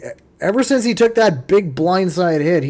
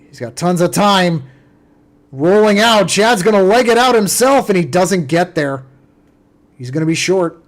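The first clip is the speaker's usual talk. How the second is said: louder, rising play-by-play call; excited call; 7 dB louder than usual. Real, not acted. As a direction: call the run as it happens. Tense play, fan calls it.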